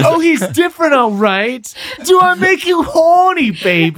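high, loud groovy British-spy voice; hammed-up Austin Powers British accent, pitched high and loud; Austin Powers impression. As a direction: swing it like Austin Powers, shouting it out. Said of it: "an Austin Powers thing".

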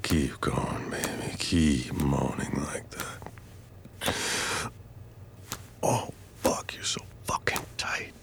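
slow, soft whispered coaxing; lingering whisper. Acted. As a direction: draw the words out in a hush.

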